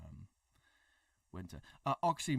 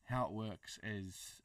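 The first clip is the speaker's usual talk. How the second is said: ordinary talk, nothing marked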